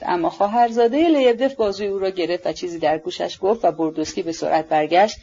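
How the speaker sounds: usual speaking voice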